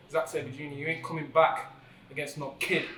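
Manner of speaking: normal talking voice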